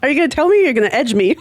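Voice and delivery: usual speaking voice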